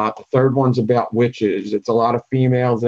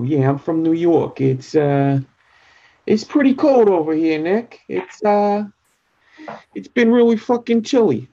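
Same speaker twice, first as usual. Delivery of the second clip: high, hammed-up New York accent; New York accent impression; 7 semitones higher than usual. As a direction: play a New Yorker griping about the cold, in a New York accent. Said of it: "you would hear".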